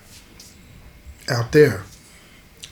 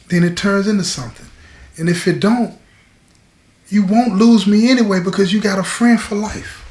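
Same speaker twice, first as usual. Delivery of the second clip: markedly higher, earnest woman's voice; reassuring woman; 7 semitones higher than usual. Acted. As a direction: promise lifelong friendship in a raised pitch. Voices woman offering lifelong friendship.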